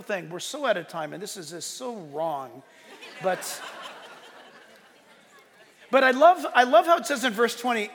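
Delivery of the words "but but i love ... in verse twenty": ordinary delivery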